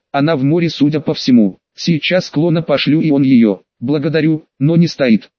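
usual speaking voice

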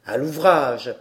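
a farmer hurrying his sons; deep paternal command; father ordering. A narrator's reading. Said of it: "interrompus par leur père".

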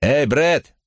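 high-pitched call from a crowd; crowd heckler's call. A narrator's reading. Someone in the crowd hails Brad, high calling voice. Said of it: "крикнули из толпы".

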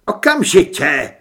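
shouted command; loud cry. A narrator's reading. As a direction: fire it out as a loud order. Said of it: "vykřikl Mikuláš tak hlasitě". (hlasitý rozkazovačný výkřik)

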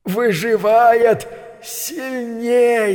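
high, slow, otherworldly whisper of a spider; eerie spider voice. A narrator's reading. Spider speaking to the rats, slow and high. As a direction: drag it out like a creeping spider. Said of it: "произнёс голос паука".

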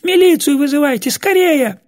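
very high, frantic yell for help; panicked scream. A narrator's reading. Old woman begs them to call the police, screaming. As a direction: wail it out in terror. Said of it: "завопила Глафира Митрофановна не своим голосом".

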